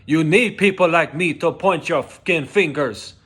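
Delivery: loud, high, heated outburst; emotional shouting. Retold or imitated. Worker reliving HR unfairness, shouting emotionally. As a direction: belt it out like Scarface at dinner. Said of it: "I'm getting emotional right now just talking about it".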